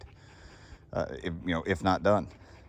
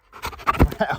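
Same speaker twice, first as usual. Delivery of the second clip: airy high-pitched laugh at the dog; high breathy giggle; 7 semitones higher, 5 dB louder than usual. Real, not acted. High laugh while cooing dog.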